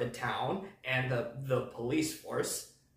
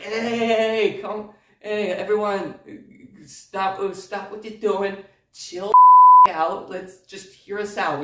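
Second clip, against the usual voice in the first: loud, raised-pitch call to a crowd; acted shout to make everyone stop; 7 semitones higher, 14 dB louder, slower than usual. Friends halting an angry crowd, calling out loud and high.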